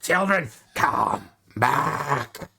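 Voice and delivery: lightly voiced dragon goddess, slow on the last call; a hint of a monstrous mother calling her brood; dragon queen calling. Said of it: "just roaring heads, going".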